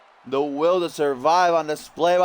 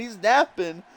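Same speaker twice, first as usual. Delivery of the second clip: ordinary talk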